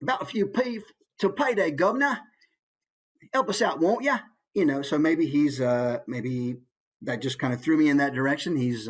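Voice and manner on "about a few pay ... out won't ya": high-pitched Cockney beggar. Shown as a demonstration. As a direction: wheedle for a handout in Cockney. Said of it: "maybe lower class".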